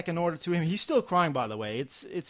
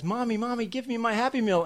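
put-on higher, whiny little-girl voice; little girl pleading; 8 semitones higher than usual. Daughter reaching for the tray, whiny child plea. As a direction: play the daughter tugging at mom. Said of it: "She pulls up her arms and says".

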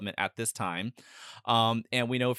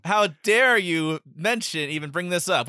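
mock outrage; 6 semitones higher, 6 dB louder than usual.

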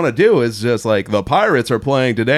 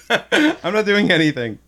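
laughing; 7 semitones higher than usual. Real, not acted.

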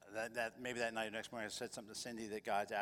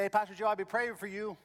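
high, loud imitation of the caller; Charlie's voice; 10 semitones higher, 11 dB louder than usual. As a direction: ham up the friendly caller. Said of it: "Charlie's Korean".

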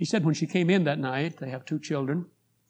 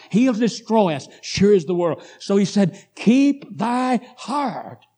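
loud, raised-pitch preaching; preaching at full force; 5 semitones higher, 6 dB louder than usual. Acted. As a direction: drive the text home to the congregation. Preaches on his text.

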